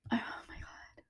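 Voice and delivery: whispered oh my God, close to tears; hushed, moved disbelief; overwhelmed whisper. Real, not acted. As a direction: breathe it out in awe. Overwhelmed recalling the verdict.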